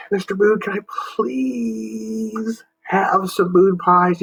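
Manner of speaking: high, slow, sugary please; sweet asking. Acted. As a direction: beg politely like to mom and dad. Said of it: "You ever do that with your mom".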